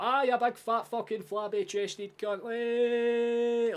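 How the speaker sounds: high, loud Scottish yell, drawn out; broad Scottish. Acted. Hurling an insult to show pure Scottishness, broad Scottish accent. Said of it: "just pure Scottishness".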